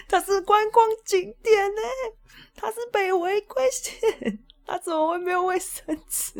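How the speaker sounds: high-pitched outrage at a tourist spot with no paper; indignant disbelief. Retold or imitated. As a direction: squeal it like you can't believe it.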